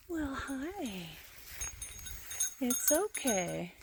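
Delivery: soft, slow, soothing coo; cooing to a scared pet. Real, not acted. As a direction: gently reassure a frightened animal. Soothing the sheep.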